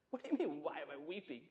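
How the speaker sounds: hushed, quick, incredulous retort; quiet disbelief. Acted. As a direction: answer under your breath, as if baffled by the question. Acted baffled reply to the angels.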